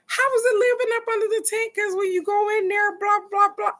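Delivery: high, fast mock voice of mom; imitating mom. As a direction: play mom teasing the frog. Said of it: "My mom laughing. She like".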